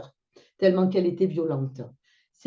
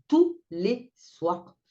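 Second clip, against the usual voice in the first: tous les soirs drawn out very slowly; tous les soirs étiré; 3 semitones higher, much slower than usual. Real, not acted. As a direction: stretch each word of tous les soirs. Récit indigné des cris. (tous les soirs très lent, appuyé)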